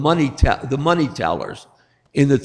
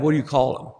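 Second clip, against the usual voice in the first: usual speaking voice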